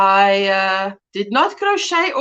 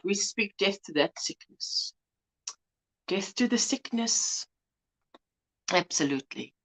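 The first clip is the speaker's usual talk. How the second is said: quiet earnest prayer; 4 semitones lower, 12 dB quieter than usual. Real, not acted. Praying against viewer's cancer.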